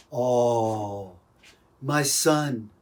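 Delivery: mock tearful dad, low drawn-out oh; welling up, imitated. Proud dad to his child after a game, imitated welling up. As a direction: softly imitate a choked-up father. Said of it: "he'd start welling up with tears".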